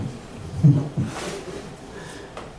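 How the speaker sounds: light wordless laugh; chuckle. Real, not acted. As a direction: chuckle at your own joke. Laughs after naming joke haiku.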